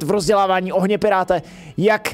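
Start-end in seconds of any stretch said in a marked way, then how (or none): 1.8-2.1 higher, scornful theatrical sneer; hammed-up villain scoffing at the pirate; mocking villain. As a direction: deride the fool from on high.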